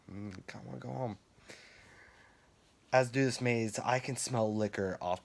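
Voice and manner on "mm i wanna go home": quick, quiet retold grumble; muttered reluctance. Tired scare actor two hours into shift, quiet grumble. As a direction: mumble it softly and fast, as if to yourself.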